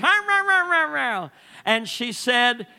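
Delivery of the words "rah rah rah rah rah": mock growly rah rah, much higher and louder; angry grumbling. Pastor imitating his mother-in-law's fuming grumble, hammed-up. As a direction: ham up her fuming grumble.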